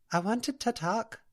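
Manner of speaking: high, lilting Scottish brogue; a gentle Scots spirit coaxing the girl; Scottish dream spirit. Acted. Alpina's Scottish voice.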